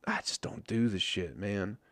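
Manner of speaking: soft confiding whisper; whispered aside. Real, not acted. Telling listeners he avoids stocks, whispered aside. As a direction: drop to a whisper like a confession. Aside about not trading.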